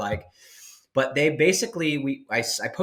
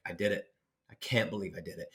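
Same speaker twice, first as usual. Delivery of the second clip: softly murmured; 5 semitones lower, 9 dB quieter, faster than usual. Retold or imitated. Quiet grin after finishing mission.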